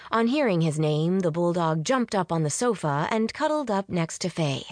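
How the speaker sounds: ordinary delivery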